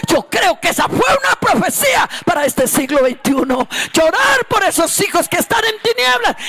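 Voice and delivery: high, straining shouted sermon; preaching at full force. Acted. Preacher proclaiming a prophecy over mothers and their children, straining shout.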